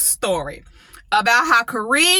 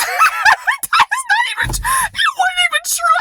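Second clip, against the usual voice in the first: laughing disbelief. Real, not acted. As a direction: laugh it off as nonsense.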